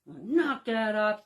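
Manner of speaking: a hint of a stern father telling kids off; mock dad scolding the kids, slow and firm; gruff dad. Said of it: "gruff voice".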